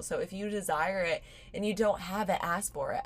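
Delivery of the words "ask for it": ordinary talk, nothing marked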